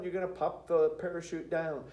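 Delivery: ordinary delivery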